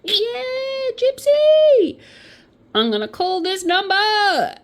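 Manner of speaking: very high, squealing mock excitement; overjoyed. Nicole hearing she won a holiday, hammed-up overjoyed squeal. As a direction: squeal as if you just won. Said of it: "She couldn't believe her luck. She was like".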